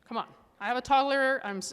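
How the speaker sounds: ordinary talk, nothing marked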